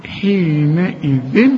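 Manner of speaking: حينئذ slow with a held nasal ghunna; slow nasalized. Shown as a demonstration. Teacher demonstrating ikhfa to student, slow. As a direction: hum the nun in حينئذ. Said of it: "التاء من حروف الإخفاء".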